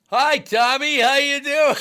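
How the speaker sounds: very high, loud, hammed-up holler; mock hearty greeting. Herbeck needling the reporter in the locker room, loud mock greeting. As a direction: imitate a pest hollering hello at full volume.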